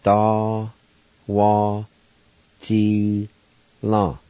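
Da, wa, ji, la each syllable separate; teacher sounding out Da, wa, ji, la. Shown as a demonstration. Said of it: "First part's pronounced like this".

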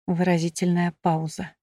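ordinary delivery, nothing marked

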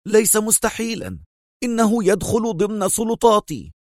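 high-pitched, angry retort; angry cry. A narrator's reading. Consul insists it is within his powers, angry. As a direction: assert authority in high indignation. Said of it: "صاح القنصل في غضب".

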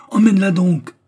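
cri très haut et très fort; rugissement furieux. A narrator's reading. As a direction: hurler l'ordre à pleine voix. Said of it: "rugit Bulba". (Bulba hurle l'ordre, hors de lui)

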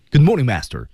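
Good morning, master clipped fast; quick and forceful. Shown as a demonstration. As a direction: punch it out quickly. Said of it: "它就是快".

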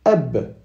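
ab with only the start of the b, lips closed and unreleased; Arabic b with sukun, cut short. Shown as a demonstration. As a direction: say ab and stop the b without any vowel after it. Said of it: "on prononce que les débuts du ba".

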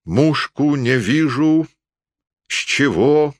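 high, drawn-out puzzled muttering; preoccupied. A narrator's reading. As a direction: puzzle slowly over the gun sight. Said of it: "сказал он озабоченно". (озабоченно, высоко и врастяжку)

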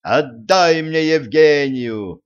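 loud, high, drawn-out call; shouted demand. A narrator's reading. Prince demands Евгения back from Кощей, shouting. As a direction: demand it across a distance.

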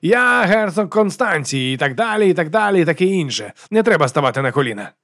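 high, affected aristocratic voice; self-introducing duke, airy. A narrator's reading. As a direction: announce your titles, then wave them away.

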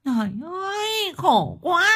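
high-pitched, drawn-out mock wail; acted whimpering cry of woe; tearful wailing. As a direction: lament like a wronged wife on the verge of tears. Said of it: "Thị Liên lắc đầu mếu máo".

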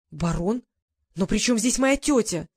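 mild shout of alarm about her aunt. A narrator's reading. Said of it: "закричала Гордыня".

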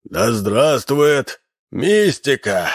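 crowing, high and slow rooster voice. A narrator's reading. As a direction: crow it out like a rooster. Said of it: "закричал петушиным голосом".

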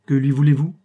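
loud, abrupt challenge; brusque. A narrator's reading. Clerk to an unknown visitor without greeting, brusque. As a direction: demand it without any greeting. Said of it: "fit le monsieur sans saluer".

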